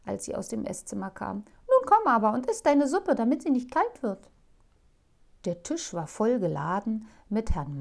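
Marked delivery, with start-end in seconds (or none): 1.7-4.3 higher, louder, fast wifely urging; fussing housewife; bustling wife. Acted. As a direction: hurry him to the table. Wife's voice in story.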